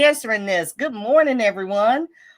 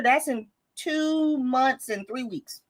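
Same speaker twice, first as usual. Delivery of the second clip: slow, deliberate; 4 semitones higher, slower than usual. Real, not acted. Stressing how soon year ends.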